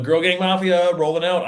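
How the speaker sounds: loud, high rallying announcement; playful crew call. Pretend. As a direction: holler it like a hype call. Announcing the friend group playfully.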